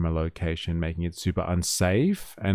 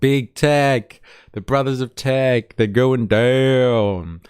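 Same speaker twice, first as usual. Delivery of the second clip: louder, higher, slow rally-style proclamation; mock rally cry; 5 semitones higher, 5 dB louder, slower than usual. Host echoing the union rally clip, mock rallying cry. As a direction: pump it up like a union speaker.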